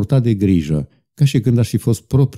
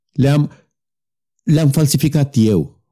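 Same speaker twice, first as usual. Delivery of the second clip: higher, breaking-out confession; sudden outburst; 6 semitones higher than usual. A narrator's reading. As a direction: blurt it out at last. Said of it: "apoi deodată izbucni". (izbucnire bruscă, cu vocea mai sus)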